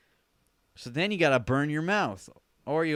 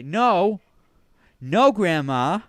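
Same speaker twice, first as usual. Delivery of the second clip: loud, higher-pitched refusal; mock alarmed scolding; 7 semitones higher, 11 dB louder, slower than usual. Stopping Grandma from grabbing oven mitts, loud scolding. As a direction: cut Grandma off loudly.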